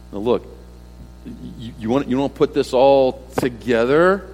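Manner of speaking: ordinary talk, nothing marked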